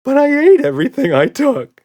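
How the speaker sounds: high, loud whiny protest; squeaky nervous creature; whiny monster. A narrator's reading. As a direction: protest helplessly. Red voiced.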